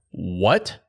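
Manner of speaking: can't believe the news; stunned disbelief. Retold or imitated. Hearing buddy found his buck.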